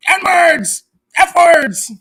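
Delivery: loud, high mock screaming of a rival. Mocking rival's meltdown, screamed high and loud. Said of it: "and he's like".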